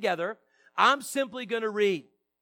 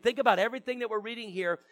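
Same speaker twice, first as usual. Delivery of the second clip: ordinary talk, nothing marked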